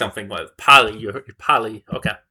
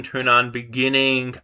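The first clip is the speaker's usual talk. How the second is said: normal talking voice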